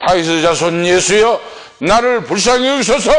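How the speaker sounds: high, forceful imitated cry for mercy; retold shouting. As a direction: act out a struggling, insistent plea, climbing in pitch. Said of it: "더욱더 소리를 지르면서". (몸부림치듯 높게 부르짖는 흉내)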